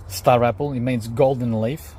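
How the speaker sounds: ordinary talk, nothing marked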